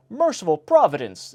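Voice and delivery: a lady shocked by the shark story; gasp. A narrator's reading. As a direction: gasp it in horror. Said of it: "his hearer gasped".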